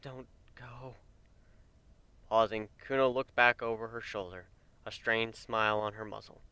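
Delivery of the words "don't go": soft, croaking plea; softly croaked. A narrator's reading. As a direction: barely get it out. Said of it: "a softly croaked sentence".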